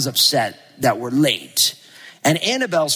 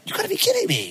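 much higher, exasperated disbelief; 3 dB quieter, faster than usual. Retold or imitated. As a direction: pitch shoots up in mock outrage. Told no flight out today.

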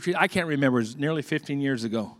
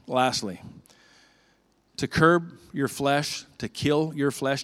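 usual speaking voice

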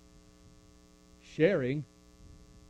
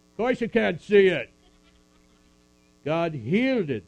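exclaiming; 6 semitones higher, 9 dB louder than usual. Acted. Exclaims about healed snakebite.

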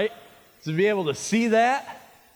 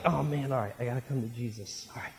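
lower, quiet, hesitant self-talk; reluctant; 6 semitones lower, 8 dB quieter, faster than usual. Acted. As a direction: mutter it softly, dragging yourself into it. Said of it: "If I was Peter, I'd be like".

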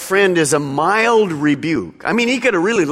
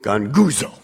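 lowered demonstration of a grumble; lightly grumbled low word; 8 semitones lower than usual. Shown as a demonstration. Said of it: "to grumble in a low tone".

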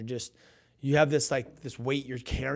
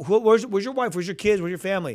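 loud, higher, insistent questions; eager questioning; 7 semitones higher, 7 dB louder than usual. Retold or imitated. Guest asking the pastor after his family, eagerly insistent. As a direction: press him eagerly, voice raised.

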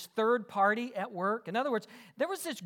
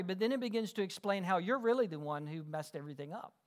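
ordinary delivery

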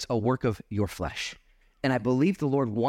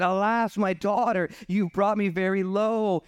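raised, slowing scripture lament; dramatic lament; 9 semitones higher than usual. A narrator's reading. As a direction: read it as an anguished father's outcry. Reads Jephthah's lament aloud.

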